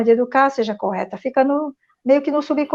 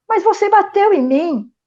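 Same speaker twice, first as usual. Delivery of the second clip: high, slow, reproachful accusation; accusing teen; 9 semitones higher than usual. Retold or imitated. Teenage son throwing the past in his mother's face, high and accusing. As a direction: throw it back at her like a hurt son. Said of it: "ele jogou na minha cara".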